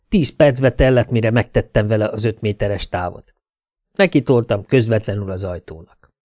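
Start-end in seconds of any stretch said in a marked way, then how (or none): none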